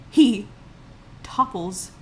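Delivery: normal talking voice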